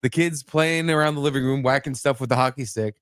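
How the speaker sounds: ordinary delivery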